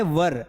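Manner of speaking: "वर" said short and low, like the Hindi word for groom; correct pronunciation. Shown as a demonstration. Teacher models were as groom's vर. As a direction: say "वर", not "वेयर". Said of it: "इसका pronunciation क्या है?".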